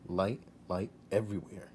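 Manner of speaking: plain reading voice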